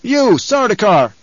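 high, loud, slow challenge; commanding hail across a chamber; calling out. A narrator's reading. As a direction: call out sternly to enemy soldiers. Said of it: "Paul called to the remaining group".

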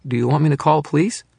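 put-on high, loud, trembling child's call; a scared young girl calling out; quavering girl's voice. A narrator's reading. Frightened daughter calls to her mother in a high quavering voice. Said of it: "she said in a quavering voice".

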